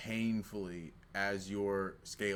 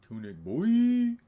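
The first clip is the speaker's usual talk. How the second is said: high mocking sing-song; teasing; 10 semitones higher, 7 dB louder than usual. Real, not acted. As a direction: tease him playfully. GM teasing the player character.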